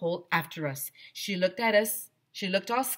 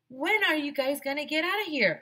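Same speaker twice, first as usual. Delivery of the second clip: high called-up question; shouting up; 10 semitones higher than usual. Acted. Arlo calling up to Mister Harrison through the hole, high. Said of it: "I shouted up at him".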